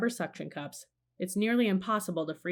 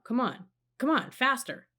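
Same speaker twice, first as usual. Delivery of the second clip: urgent urging; 6 semitones higher than usual. A narrator's reading. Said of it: "Jack ordered the mini sub".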